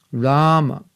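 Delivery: Rama with a stretched first A and a short final A; long first A. Shown as a demonstration. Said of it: "The first A is long and the last A is short".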